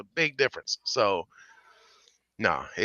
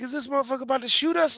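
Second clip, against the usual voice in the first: mock alarm; 9 semitones higher, 4 dB quieter, faster than usual. Co-host voices the worried SUV men.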